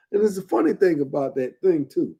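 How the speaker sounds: usual speaking voice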